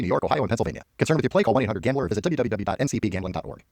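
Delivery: fast, quiet legal fine print; rapid disclaimer. Acted. Announcer rushing the gambling disclaimer, fast. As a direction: rattle off the terms at top speed.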